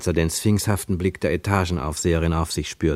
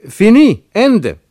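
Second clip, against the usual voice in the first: short, high, loud shouts; desperate shouting down the phone; yelling; 12 semitones higher, 10 dB louder, slower than usual. A narrator's reading. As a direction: yell each word to end the call. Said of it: "schrie er".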